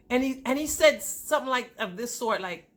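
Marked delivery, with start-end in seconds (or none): none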